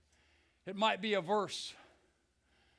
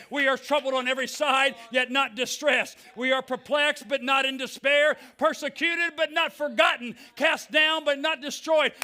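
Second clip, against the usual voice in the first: preaching at full force, building louder; 3 semitones higher, 5 dB louder than usual. Real, not acted. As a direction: build the sermon up to a shout. Preaching scripture at rising force.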